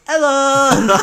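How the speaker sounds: high, loud silly hello; a goofy tipsy friend calling from the background; drunk mate's hello. Retold or imitated. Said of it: "Matty's just in the background going".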